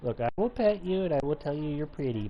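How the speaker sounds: usual speaking voice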